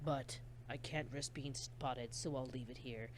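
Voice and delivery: a killer murmuring to himself over the body; creepy soft killer. Acted. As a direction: relish it like a quiet psychopath. S1 voicing the killer.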